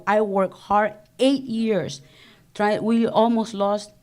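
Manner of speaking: ordinary talk, nothing marked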